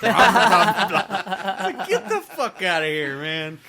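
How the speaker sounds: loud laughing brush-off; mock dismissal. Host dismissing a pedantic podcast commenter, laughing mock outrage. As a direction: wave them away loudly, half laughing.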